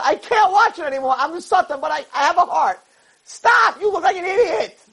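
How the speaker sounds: very high, strained plea; exasperated outcry. Acted. Satan begging Yaakov to stop pushing, high exasperated outcry. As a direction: cry out as if you can't bear it.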